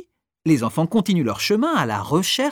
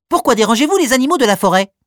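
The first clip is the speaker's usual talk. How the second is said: lapin agacé, sec, rapide et plus aigu; sèchement; 8 semitones higher, 6 dB louder, much faster than usual. Acted. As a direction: questionne d'un ton sec et pressé. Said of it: "questionne-t-il sèchement". (un lapin agacé questionne les enfants, sèchement)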